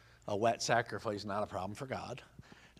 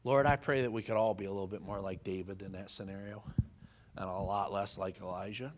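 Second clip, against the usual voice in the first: prayer; 5 semitones lower than usual. Acted. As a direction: pray it humbly to the Lord. Closing prayer.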